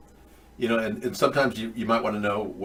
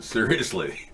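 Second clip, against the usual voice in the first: usual speaking voice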